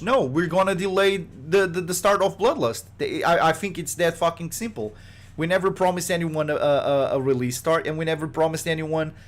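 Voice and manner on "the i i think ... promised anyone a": ordinary talk, nothing marked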